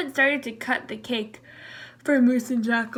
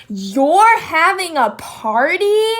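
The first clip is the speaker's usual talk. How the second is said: slow, higher whine; whiny; 5 semitones higher, 8 dB louder, slower than usual. Acted. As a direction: Jackal whining at Zebra about the party, whiny and slow. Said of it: "whined Jackal".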